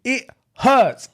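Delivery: mild mock whine of a little brother; whinier complaint. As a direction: push the whine up on "hurts". Brother complaining again, higher.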